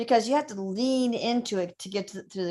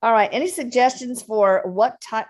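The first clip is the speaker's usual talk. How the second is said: ordinary talk, nothing marked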